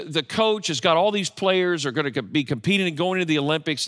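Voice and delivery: normal talking voice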